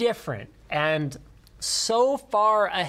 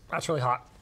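eating spicy food; mouth full; 4 semitones lower, faster than usual. Real, not acted. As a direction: talk through a hot mouthful. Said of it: "I bit into a seed".

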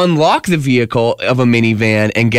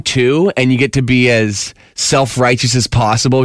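ordinary talk, nothing marked

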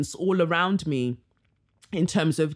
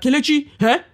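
loving Nigerian father, higher and louder; big fond Nigerian accent; Nigerian dad voice; 9 semitones higher, 7 dB louder than usual. Retold or imitated. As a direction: imitate a Nigerian dad calling his daughter. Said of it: "he'd say it in a very Nigerian man voice".

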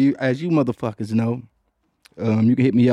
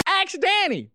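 announcer voice; 3 dB quieter, slower than usual. Acted. Segment title announced.

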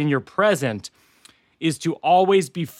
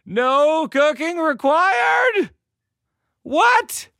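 crazy excited; 12 semitones higher, much slower than usual. Acted. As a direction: explode with mock surprise. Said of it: "is when I get crazy".

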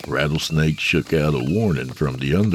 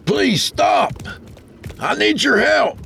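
high, loud call across the desert; hollering; 3 dB louder than usual. A narrator's reading. Tony hollers to the wagon driver, high and loud. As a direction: shout to flag down the wagon. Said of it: "he hollered".

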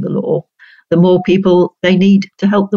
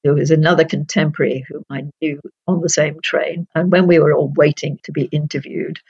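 ordinary talk

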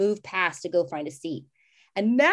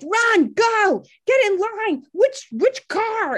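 high, loud frantic urging; mock New York train scramble; frantic rush; 13 semitones higher, 6 dB louder, much slower than usual. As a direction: hurry everyone along in a panic.

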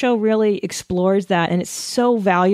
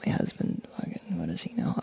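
hushed, much lower aside; whisper; 13 dB quieter than usual. Pretend. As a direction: whisper it under your breath. Muttered aside, unvoiced.

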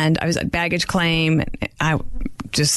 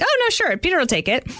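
eager to help; 10 semitones higher than usual. Retold or imitated. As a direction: start way up, eager to pose. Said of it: "I'm like".

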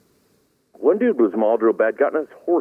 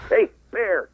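scaring off a bear; 10 semitones higher than usual. Retold or imitated. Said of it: "I'm gonna yell at the bear".

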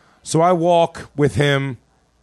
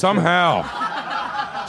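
laughing; 4 semitones higher, much slower than usual. Real, not acted. Laughing wry comeback.

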